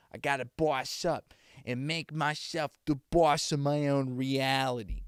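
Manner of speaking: loud, hammed-up Boston accent. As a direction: belt it out in a Boston accent, stretching the end. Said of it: "a Boston accent".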